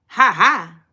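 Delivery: ordinary talk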